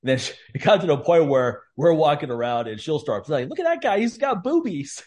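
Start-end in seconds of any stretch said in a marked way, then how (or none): 3.5-5.0 much higher mock of the wife blurting it out; mock loud wife. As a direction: pitch way up, blurt it like Carol in public. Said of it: "she says it loud".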